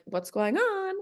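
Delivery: high-pitched, lilting query; retold eager question; imitating curious question. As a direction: ask with lifted, lightly mock curiosity.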